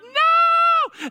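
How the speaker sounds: high loud shouted no; mock toddler shout. Preacher mimicking a stubborn child yelling no. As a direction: yell no like a defiant toddler. Child refusing, God analogy.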